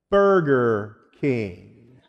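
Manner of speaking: extremely slow, each syllable of Burger King drawn out; slow and clear. Acted. Drive-through worker naming the place, very slowly and clearly. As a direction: spell it out slowly for a dim customer. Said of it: "pronounce it slowly and clearly".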